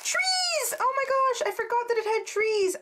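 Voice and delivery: high, excited squeal of discovery; delighted squeal. Real, not acted.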